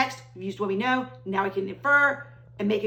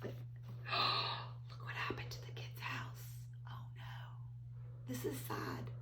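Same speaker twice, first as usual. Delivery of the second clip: hushed sad whisper; 12 semitones lower, 16 dB quieter, much slower than usual. Acted. Hushed sadness at wrecked house.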